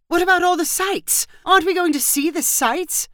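high-pitched indignant questions; dismayed protest. A narrator's reading. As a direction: protest a spoiled plan, pitch way up. Wife protesting the husband's plan.

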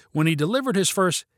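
ordinary delivery, nothing marked

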